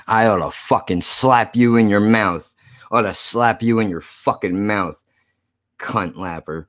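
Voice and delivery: usual speaking voice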